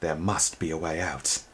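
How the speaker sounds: quiet, grim mutter to himself. A narrator's reading. Twig alone in the cavern, grim mutter. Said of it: "he muttered grimly".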